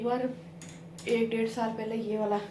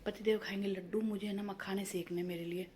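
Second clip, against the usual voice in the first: hushed half-whisper, much softer; whispering quietly; 10 dB quieter than usual. Real, not acted. To viewers while husband sleeps, soft whisper. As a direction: keep it down so nobody wakes. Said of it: "इसीलिए मैं थोड़ा सा धीरे धीरे बोल रही हूँ".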